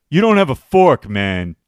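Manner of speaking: loud jeering bro voice, laughing at the end; mocking dude voice. Smug guy mocking a man with no fork, jeering. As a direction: poke fun at the missing fork. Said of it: "He's like".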